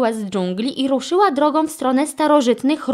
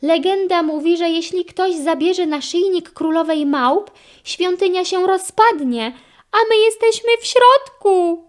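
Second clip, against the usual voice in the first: high voice building to a loud panicked peak; increasingly panicked, pitched high; frightened; 9 semitones higher, 3 dB louder than usual. A narrator's reading. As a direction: warn with rising alarm. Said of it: "krzyknął przerażony Carlos".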